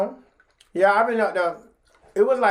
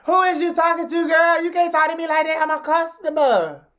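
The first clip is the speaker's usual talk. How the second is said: high, pushed, offended customer voice; indignant sass; 9 semitones higher than usual. Retold or imitated. Customer telling off the bartender, high and offended. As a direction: put her in her place, pitched way up. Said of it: "Like, like".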